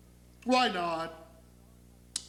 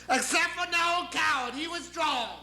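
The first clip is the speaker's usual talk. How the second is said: high, emphatic deity; insistent, ranting exception; God; 9 semitones higher than usual. Acted. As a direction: proclaim it at full almighty pitch. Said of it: "because I'm God".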